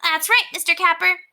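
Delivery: put-on high, self-satisfied kid; pleased boy. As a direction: chirp it up proudly. Scooter pleased with himself.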